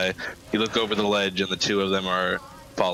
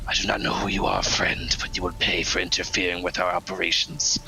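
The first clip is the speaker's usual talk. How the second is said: raspy Rakshasa growl. Acted. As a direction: snarl it out like a cat creature. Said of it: "It speaks in a raspy voice".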